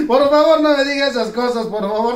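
high, loud exasperated plea; loud mock pleading.

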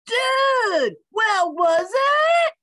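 high, loud, stretched demand; urgent dude shout. A narrator's reading. Dog rushes to the tree for an answer, shouting. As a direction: yell it up into the tree.

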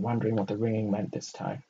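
plain reading voice

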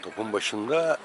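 live sports call, measured build; play-by-play commentary. Acted. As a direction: call each penalty as it happens. Live penalty shootout commentary.

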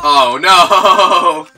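loud high cry of no; real despairing shout. Real, not acted. Player losing the match yells in real dismay. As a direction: yell it as the game is lost.

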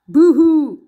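loud mock-sob growl from a monster; a monster's loud fake whimper, growled; growled monster boo-hoo. A narrator's reading. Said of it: "growled the monster".

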